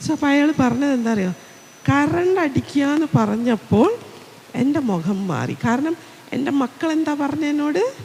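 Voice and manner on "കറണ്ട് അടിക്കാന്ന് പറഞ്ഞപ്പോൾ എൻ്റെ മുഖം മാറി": ordinary talk, nothing marked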